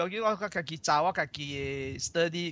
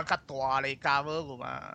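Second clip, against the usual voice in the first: ordinary talk, nothing marked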